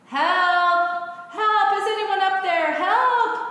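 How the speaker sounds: high, loud desperate cries for help; a man hanging from a branch bellows for rescue; yelling for help. Acted. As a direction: shout up the cliff, frantic to be heard. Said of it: "So Jack began yelling for help".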